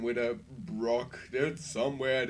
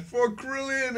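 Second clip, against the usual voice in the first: mock battle cry; 9 semitones higher than usual. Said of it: "and then he said".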